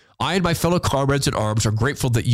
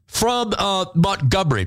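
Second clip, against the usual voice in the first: ordinary talk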